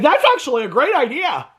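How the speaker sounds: high put-on voice mimicking Cody; mocking fake voice. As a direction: parody his fake TV voice. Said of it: "this fake voice that he's done".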